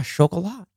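Chocolat said "sho-ko-LAH", softly; French-style pronunciation. Retold or imitated. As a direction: affirm Chocolat with SH and no final T. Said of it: "You mean chocolate?".

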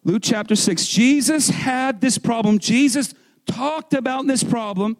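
full-voiced, emphatic proclamation; loud preaching. Real, not acted. Preacher returning to Luke chapter six, loud preaching. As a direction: punch it out loud to the room. Back to his own yelling style.

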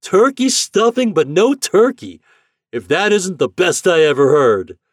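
high, loud, incredulous holler; hearty shouted amazement; amused captain. A narrator's reading. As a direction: exclaim at a great joke across the water.

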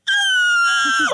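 sudden high wail of pain; pained cry. Retold or imitated. Showing mom the wound, a high pained wail. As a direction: cry out like the nipple just tore.

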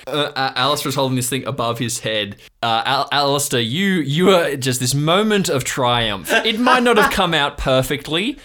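ordinary delivery

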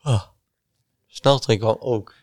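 speaking through a yawn. Real, not acted. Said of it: "Word je moe, [name]?".

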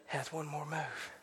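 soft, low, with a long pause midway; quiet, low promise to the hurting; hushed assurance. Real, not acted. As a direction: let it sink almost to nothing. Tender close after the shouting.

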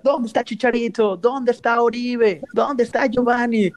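mocking taunt. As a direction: jeer it like a rival fan on a bus. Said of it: "empieza a gritar".

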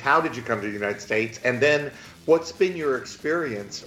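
ordinary delivery

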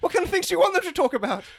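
high, fast frantic squeak; panicked pleading. Acted. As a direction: gabble in a panic. Panicked suspect.

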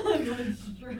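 laughing through the words; giggling it out. Real, not acted. Mocking co-host, laughing.

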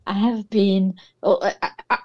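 ordinary delivery

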